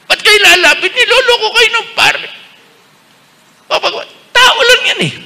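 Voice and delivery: high, loud scoffing voice; mocking skeptic. Bishop mimicking a scoffer sneering at the priest, high and loud. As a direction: scoff like a doubter laughing at the priest.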